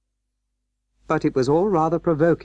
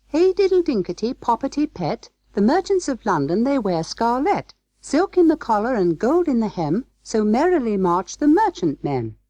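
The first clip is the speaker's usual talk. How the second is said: very high mouse-voice rhyme; little mice; 11 semitones higher than usual. A narrator's reading. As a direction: chant merrily as tiny mice. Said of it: "answered the little mice".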